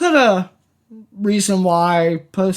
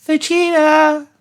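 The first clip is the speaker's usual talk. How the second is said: put-on high kid voice; Goten; 9 semitones higher than usual. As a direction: play a young boy asking cheerfully. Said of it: "we should get Goten to see what".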